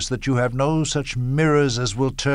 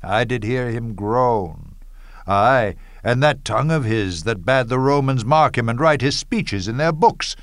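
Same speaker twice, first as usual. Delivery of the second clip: ordinary talk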